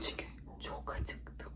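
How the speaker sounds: hushed, voiceless whisper. Real, not acted. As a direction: whisper so nobody hears. Whispering in the building. (fısıltıyla)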